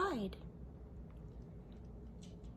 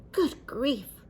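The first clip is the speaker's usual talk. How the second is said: louder startled gasp; 3 semitones higher, 7 dB louder than usual. A narrator's reading. Grandpa startled as the toboggan takes off, louder. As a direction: gasp out in shock. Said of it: "gasped Grandpa Dan".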